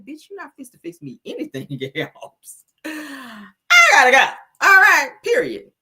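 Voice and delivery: sass building to a loud period; full attitude. Retold or imitated. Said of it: "I was in full attitude mode".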